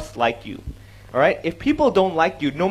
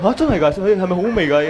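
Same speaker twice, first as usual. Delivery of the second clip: louder amazed questioning; credulous surprise; 4 dB louder than usual. Acted. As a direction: play the listener who believes it. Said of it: "People actually believe it".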